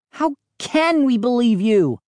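high, indignant challenge; demanding. A narrator's reading. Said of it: "I demanded".

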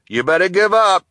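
high, loud taunting call; delivery man calling to hidden kids. Acted. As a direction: yell it through the store. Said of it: "he called".

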